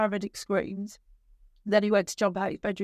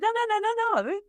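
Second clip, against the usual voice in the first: higher, pleading run of no's; retold desperate plea; 13 semitones higher than usual. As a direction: beg him to stop, voice climbing high. Mother retells her own plea.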